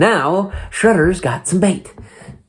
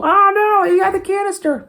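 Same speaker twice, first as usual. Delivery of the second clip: squeaky falsetto panic, much higher; cartoon alarm in a squeaky high voice; mock panic. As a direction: shriek it in a silly high falsetto. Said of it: "and they go".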